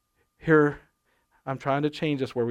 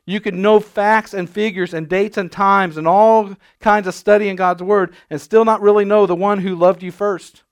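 preaching; 6 semitones higher, 10 dB louder than usual. Acted. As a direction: preach it passionately. Preaching at full force.

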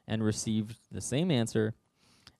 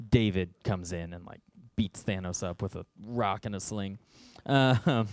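ordinary talk, nothing marked

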